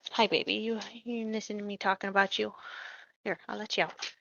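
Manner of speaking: soft, cooing words to the dog. Real, not acted. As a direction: hush down and coo at your pet. Talking to her dog.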